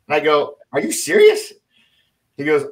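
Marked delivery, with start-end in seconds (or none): 0.7-1.5 retold disbelief, pitched very high and louder; incredulous retort. Captain told to wait by the elevator questions the colonel, incredulous.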